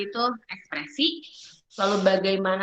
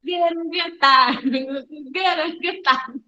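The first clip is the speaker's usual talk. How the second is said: slow, stretched frustrated groan of a word; slow drawn-out; 6 semitones higher, much slower than usual. Shown as a demonstration. Teacher mimics a muted student, slow and exasperated. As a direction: drag it out in frustration. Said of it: "Kayak nonton film bisu".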